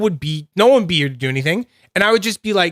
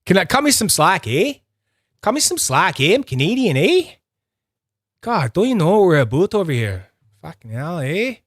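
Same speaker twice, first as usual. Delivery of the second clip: hammed-up Canadian accent, swooping in pitch; exaggerated Canadian lilt with a rising "eh"; 4 semitones higher than usual. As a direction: lay the Canadian accent on thick. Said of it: "yeah, I am Canadian".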